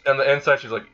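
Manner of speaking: plain reading voice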